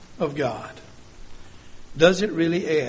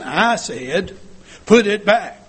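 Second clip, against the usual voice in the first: raised voice, higher and much louder; acted parent losing patience, louder on each word; loud stern command; 4 semitones higher, 7 dB louder, slower than usual. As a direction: order a child firmly and forcefully. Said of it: "The stronger I talk, the louder I talk, the meaner I look".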